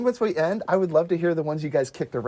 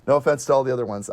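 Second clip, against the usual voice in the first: normal talking voice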